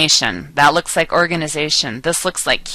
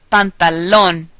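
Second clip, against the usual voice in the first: pantalón with stress on final LÓN; stress on accented vowel; 3 dB louder than usual. Shown as a demonstration. Teacher giving an example, final-syllable stress on pantalón. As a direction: lean on the last syllable of pantalón. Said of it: "the syllable that you will stress".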